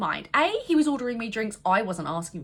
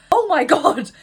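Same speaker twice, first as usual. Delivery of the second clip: exasperated; 5 semitones higher, 8 dB louder, slower than usual. Real, not acted. Exasperation at the story.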